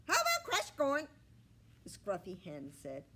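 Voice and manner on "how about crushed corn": very high, loud, fast hen squawk; squawky hen. A narrator's reading. Scruffy hen haggles with the pig, squawking high.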